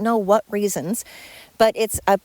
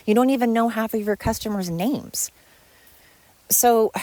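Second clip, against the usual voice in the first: usual speaking voice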